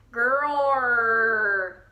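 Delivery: loud drawn-out bear growl. A narrator's reading. Old Bear growls when told he eats like a bear, a loud growl. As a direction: let the growl roll out.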